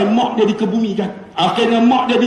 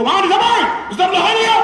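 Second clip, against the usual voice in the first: high-pitched mimicry of a brusque boss; imitating a sharp-tongued principal; principal's usual manner; 10 semitones higher than usual. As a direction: answer the phone in a much raised voice. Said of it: "Dia cakap memang macam tu".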